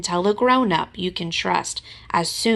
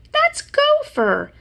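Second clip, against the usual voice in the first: delighted realization; 5 dB louder, slower than usual. Acted. Pooh recognizes, giggling.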